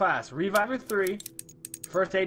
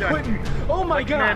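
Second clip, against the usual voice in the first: loud, higher yelling; raging gamer; 9 semitones higher, 7 dB louder than usual. Real, not acted. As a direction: yell over the chaos. Teammate dies during fight.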